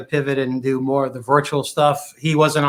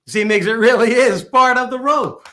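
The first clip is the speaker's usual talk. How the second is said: triumphant magician; 8 semitones higher, 3 dB louder than usual. Acted. Insisting in the routine.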